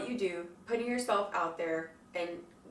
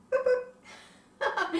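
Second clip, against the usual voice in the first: high, squeaky little boops; playful boop. Real, not acted. Vlogger booping the viewer's nose, playful high chirp.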